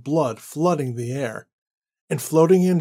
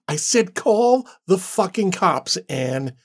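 slow, higher, forceful yell with "call" hit hard; yelled order; 4 semitones higher, slower than usual. Acted. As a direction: bark the order at her, slowly and hard. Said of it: "he yelled".

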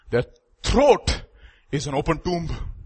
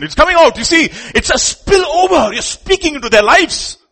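building preacher's shout; 7 semitones higher, 5 dB louder than usual. Real, not acted. Preacher to parents, shouting about a spillover.

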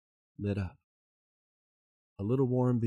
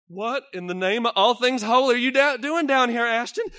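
high, loud distress; a husband crying out to his buried wife; anguished disbelief; 11 semitones higher, 9 dB louder than usual. Acted. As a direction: cry out in disbelief. Billy finds wife under rocks.

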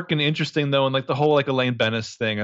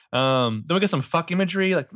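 ordinary talk, nothing marked

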